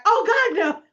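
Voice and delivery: high, loud frightened cry; mock fright. Couple scared of the fake dog, high loud cry. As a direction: recoil in horror.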